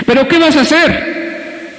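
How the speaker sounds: mucho más agudo y lento, casi gritado; reto agudo. Retold or imitated. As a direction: estira la pregunta hacia arriba. Predicador repite el reto. (el pastor reta al hermano tirado, agudo y lento)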